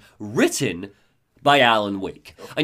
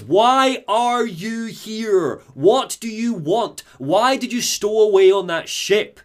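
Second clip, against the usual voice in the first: higher, slow pressing questions; interrogator demanding; 6 semitones higher, 3 dB louder, much slower than usual. Retold or imitated. Retelling the interrogator grilling the alien prisoner. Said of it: "And then the interrogator goes".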